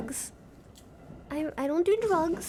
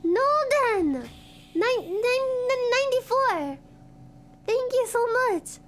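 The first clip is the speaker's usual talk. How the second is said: high, raised voice of real gratitude; pitched-up, delighted shout-out; excited thanks; 8 semitones higher than usual. Real, not acted. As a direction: exclaim it, thrilled by a gift. Thanking viewer for gifted subs.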